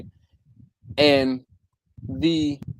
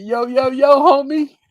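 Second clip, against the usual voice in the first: loud, high mock homeboy greeting; hype greeting; 9 semitones higher, 6 dB louder than usual. Reading a joking comment aloud, loud playful greeting. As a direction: call it out playfully, pitched up.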